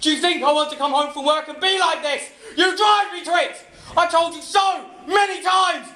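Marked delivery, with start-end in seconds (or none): 2.6-5.9 angry shout. Acted. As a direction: yell it at her in full fury. Husband shouting at wife.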